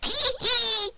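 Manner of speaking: high, gleeful little giggle; pleased tee hee. A narrator's reading. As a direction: giggle like a mischievous imp. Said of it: "in a pleased sort of way".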